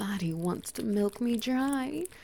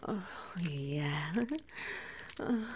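breathy, unvoiced opening sigh of words; whisper; 2 semitones lower, 7 dB quieter than usual. Lover opening the intimate scene, whispering. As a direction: draw the listener in with a hush.

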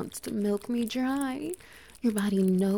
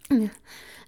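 short, pleased chuckle, a bit louder; a smug little laugh; 2 semitones higher, 7 dB louder than usual. Acted. Dominant lover chuckles at partner, pleased.

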